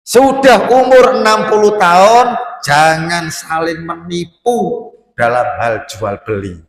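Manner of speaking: very loud, high and slow sermon call; preaching at full force. Acted. Preacher warning elders against cheating in trade, loud and slow. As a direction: boom out the rule about honest trade.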